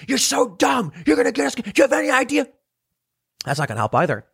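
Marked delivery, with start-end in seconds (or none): 0.1-2.5 high, mock-furious yelling; mock angry yell. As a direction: berate the backward passengers like an angry rider. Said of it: "people who are angry, yelling".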